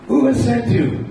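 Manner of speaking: high, loud questioning woman's voice; a high, pressing question to the serpent; Vidyavali's voice. Acted. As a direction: ask it sharply up high as the disguised Vidyavali. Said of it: "Krishna assumes these voices".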